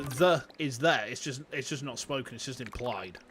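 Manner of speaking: usual speaking voice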